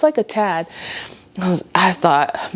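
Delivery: ordinary talk, nothing marked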